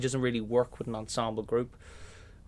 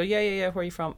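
eager reply; 8 semitones higher, 3 dB louder, faster than usual. Retold or imitated. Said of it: "And I go".

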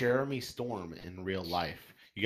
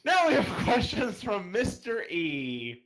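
laughing through the intro, higher and slow; 10 semitones higher, 4 dB louder, slower than usual. Real, not acted. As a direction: push the line out through giggles. Host laughing through segue.

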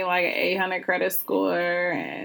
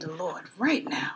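hushed church-lady whisper; 3 semitones lower, 9 dB quieter than usual. Retold or imitated. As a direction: breathe it like a prayer.

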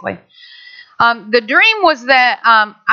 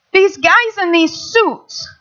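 usual speaking voice

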